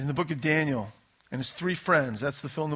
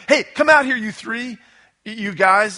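calling out, much higher and louder; freaked-out king calling; 5 semitones higher, 9 dB louder than usual. Retold or imitated. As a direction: yell at them to come out of the fire.

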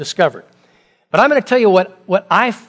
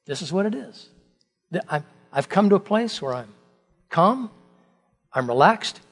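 quietly calm; 6 dB quieter than usual. Acted. David voiced calmly, softly.

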